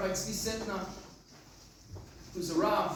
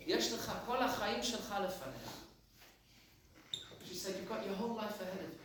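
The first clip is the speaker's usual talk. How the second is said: softer, hushed voicing of an old lady; gentle, soft warning; 3 semitones lower, 10 dB quieter, faster than usual. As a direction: tell him softly, like a grandmother who has lost someone. Elderly lady's gentle warning.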